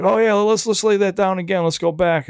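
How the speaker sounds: mock eager client asking for another take; insistent artist. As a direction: push it high and loud, mimicking the artist.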